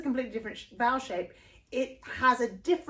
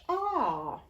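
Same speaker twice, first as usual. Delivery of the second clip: posh English ah; 4 semitones higher than usual. Shown as a demonstration. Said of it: "Today we're talking very posh English".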